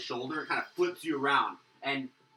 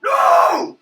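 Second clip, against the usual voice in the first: very high, very loud strained cry; a troll bellowing over his dead pet; troll's anguished no; 22 dB louder than usual. Acted. Troll sees his drake blown apart, anguished cry. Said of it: "and he goes".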